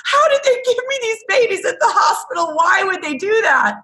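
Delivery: very high, wailing disbelief; hammed-up panic of an overwhelmed parent; mock despair. Parent doubting she should have these babies, mock despair.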